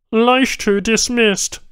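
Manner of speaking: high, loud, slow Banu reply; curt alien tech. A narrator's reading. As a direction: repeat it firmly and flatly. Tech repeats answer.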